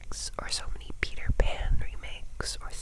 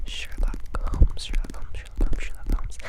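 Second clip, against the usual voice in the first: asmr trigger words. Acted.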